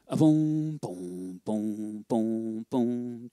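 low sung boom boom imitating a double bass; walking bass hum. Shown as a demonstration. Teacher humming the bass line for drum students, low legato booms. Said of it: "what we call the walking bass".

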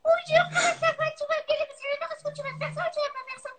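high squeaky cartoon bird voice; tiny silly cartoon voice; Titi cartoon voice. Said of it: "une petite voix de dessin animé".

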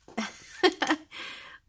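high delighted giggle; a little burst of happy laughter. Real, not acted. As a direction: giggle at a sweet surprise. Delighted by friend's note.

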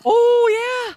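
thrilled gasp of discovery; excited gasp. Real, not acted. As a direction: gasp then breathe yeah in awe. Discovering the giant cave.

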